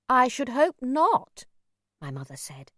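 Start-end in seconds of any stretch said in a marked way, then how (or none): 0.1-1.4 slow, haughty rebuke, raised in pitch and volume; sharp. A narrator's reading. Mother rebukes the old stranger, sharply and slowly. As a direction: cut him down with cool disapproval. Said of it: "embarrassed by my mother's sharpness".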